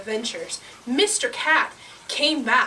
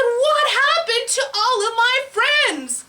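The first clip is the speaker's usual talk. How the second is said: high, dismayed cry; worried horse; 12 semitones higher, 4 dB louder than usual. Acted. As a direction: wonder aloud where everyone went. Horse cannot find his friends.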